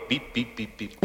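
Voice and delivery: high, soft electronic beeping; imitated alarm beeps. Hero reporting the red light to the secretary, imitated beeping. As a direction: beep like a little warning light.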